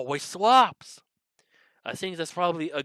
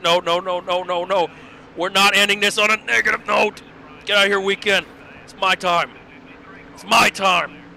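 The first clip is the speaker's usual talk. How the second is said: loud, higher, urgent racing outburst; excited shouting; 5 semitones higher, 6 dB louder than usual. Real, not acted. Fighting off [name] on the last lap, excited shouting. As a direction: shout down a rival mid-race.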